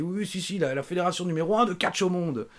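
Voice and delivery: high, hyped-up promoter impression; mock over-eager sales pitch; imitated insistence. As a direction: boast insistently in a put-on high voice. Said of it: "il insistait".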